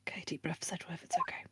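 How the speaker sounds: whispering. Acted. Nervous inner pep talk.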